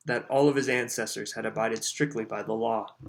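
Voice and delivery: usual speaking voice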